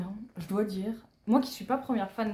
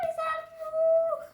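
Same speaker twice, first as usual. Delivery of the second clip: fangirl squeal; much slower than usual. Real, not acted. Fangirling over photos of members.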